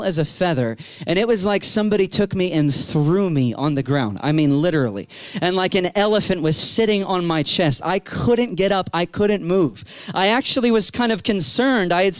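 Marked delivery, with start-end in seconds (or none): none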